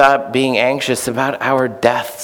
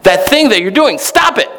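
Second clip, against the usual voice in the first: sudden shout; 9 semitones higher than usual. Retold or imitated. Therapist suddenly shouting at his eager patient. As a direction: bark the order out of nowhere. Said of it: "Bob Newhart says".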